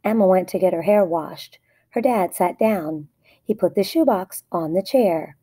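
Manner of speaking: ordinary delivery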